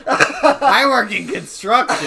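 bursting out laughing while shouting. Real, not acted.

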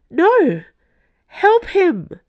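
loud, high-pitched desperate shout; panicked cry. A narrator's reading. Woman begging nuns to save friend, panicked.

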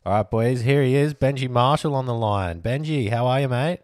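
loud hype-man introduction; guest introduction. Acted. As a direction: proudly present the big guest. Host introduces surprise guest on line.

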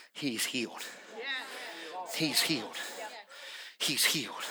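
soft repeated declaration. Real, not acted.

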